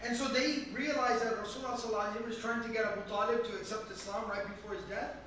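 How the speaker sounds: ordinary talk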